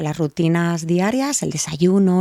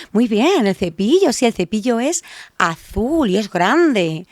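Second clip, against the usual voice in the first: put-on sing-song encouragement to a small child; praising a toddler; 4 semitones higher, slower than usual. Shown as a demonstration. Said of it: "Muy importante la prosodia". (madre elogiando a su hijo pequeño con entonación exagerada)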